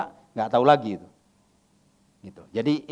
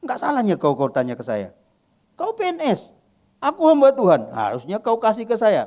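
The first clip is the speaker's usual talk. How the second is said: retold in a lively Batak accent, pitched up high; 9 semitones higher, 5 dB louder than usual. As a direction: tease a friend in a hearty Batak accent. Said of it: "Saya pakai logat, logat, logat Batak pula kan".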